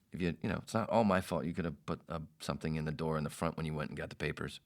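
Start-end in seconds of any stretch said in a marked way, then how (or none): none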